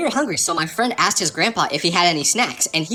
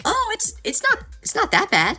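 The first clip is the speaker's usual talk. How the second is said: high, halting mock reassurance; trying to be nice. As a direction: stammer out a high, unconvincing comfort, imitating the kids. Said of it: "kids who would try being nice".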